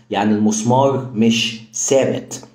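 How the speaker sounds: normal talking voice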